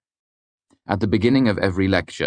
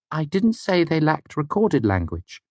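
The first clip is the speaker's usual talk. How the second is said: ordinary delivery, nothing marked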